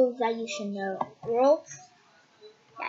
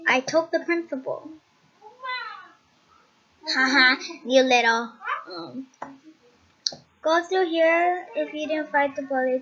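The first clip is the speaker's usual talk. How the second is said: ordinary delivery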